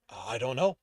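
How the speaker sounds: a touch of nervous trembling. A narrator's reading. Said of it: "Leroy's voice trembled".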